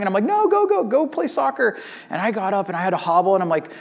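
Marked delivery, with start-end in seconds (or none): 0.2-1.8 high, strained mock brave face; pained dad. Hurt dad sending daughter to play soccer, mock-pained urging. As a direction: wave her off in a pitched-up voice.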